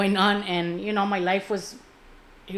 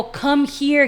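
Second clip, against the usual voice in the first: loud, higher-pitched summons; calling over; 6 semitones higher, 8 dB louder than usual. Retold or imitated. Mother calls her son over for a kiss, calling out. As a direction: call someone over from across the room.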